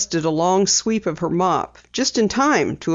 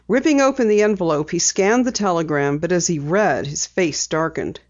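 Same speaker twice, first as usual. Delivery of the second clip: ordinary delivery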